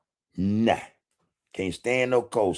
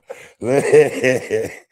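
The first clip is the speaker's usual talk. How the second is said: loud burst of laughter; 4 semitones higher, 7 dB louder than usual. Real, not acted. Laughing at his own joke.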